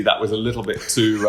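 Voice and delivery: normal talking voice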